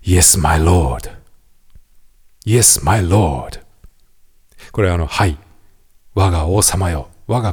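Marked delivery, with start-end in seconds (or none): 0.0-3.6 slow, solemn vow; loyal knight. Acted. Knight kneeling before his king, solemnly sworn. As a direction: kneel and pledge obedience slowly. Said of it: "こんな風に言うシーン".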